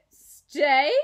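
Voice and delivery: loud shocked one-word exclamation; horrified. A narrator's reading. Mother horrified at the boy staying, loud. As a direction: recoil in horror at the idea. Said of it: "in a horrified voice".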